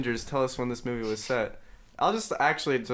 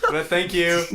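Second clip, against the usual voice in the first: calling out loud to another room; yelling across the room; 6 semitones higher, 8 dB louder than usual. Real, not acted. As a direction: shout thanks to someone far away. Calling thanks to mom.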